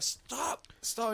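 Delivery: high-pitched mock yell, held back in volume; retold shout. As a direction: call out a high, urgent stop without full volume. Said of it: "Cass and I shout out".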